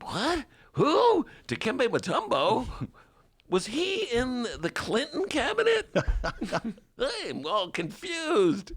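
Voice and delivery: high, baffled mock voice with laughs; confused Bernie bro. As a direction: play a clueless fan. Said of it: "All my Bernie bro listeners".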